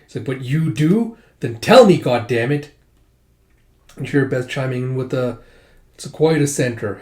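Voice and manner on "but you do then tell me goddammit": angry demand. Acted. Joanna demands her father tell her what Hawk wants, angry shout. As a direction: throw his words back at him. Daughter challenges father angrily.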